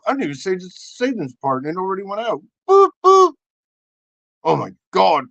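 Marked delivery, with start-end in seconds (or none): 2.7-3.3 two loud imitated beeps; quick mock alert boops; double high boop. Imitating automatic noise.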